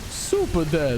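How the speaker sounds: light impression of Rotterdam's catchphrase; punchy caster call, lightly imitated. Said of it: "the Rotterdam line".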